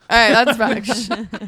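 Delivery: slow laughing reply. Real, not acted. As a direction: say it through a laugh.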